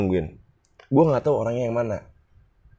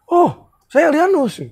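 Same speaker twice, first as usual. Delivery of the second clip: much higher and slow introduction; imitating Elianus; 3 dB louder, slower than usual. Elianus finally introducing himself, high and slow. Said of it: "gitu kan".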